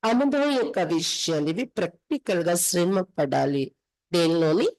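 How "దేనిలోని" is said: ordinary delivery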